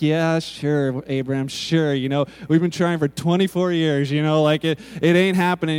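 low, loud, dismissive skepticism; mock laughing disbelief. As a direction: play up her incredulous brush-off. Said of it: "so she starts laughing".

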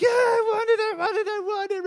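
put-on high, loud whining child begging for candy; whiny child. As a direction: beg like a kid at the checkout. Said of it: "your kids ... they're like".

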